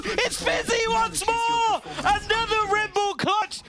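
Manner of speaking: excited shout. Real, not acted. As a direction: build to a roaring clutch call. Clutch climax call.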